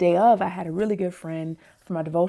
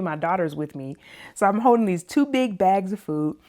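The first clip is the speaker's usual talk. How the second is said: usual speaking voice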